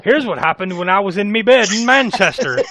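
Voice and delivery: a comic Irish brogue, half by accident; mock Irish accent. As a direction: slip into a hammed-up Irish lilt. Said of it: "I went full leprechaun there".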